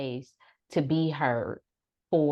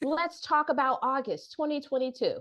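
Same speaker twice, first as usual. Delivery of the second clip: higher, playfully pointed; knowing therapist; 7 semitones higher than usual. Retold or imitated. Therapist nudging a client to open up, higher playful voice. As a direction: lean in like you caught them. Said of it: "I may say, like".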